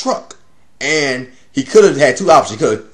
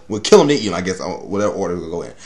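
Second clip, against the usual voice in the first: ordinary talk, nothing marked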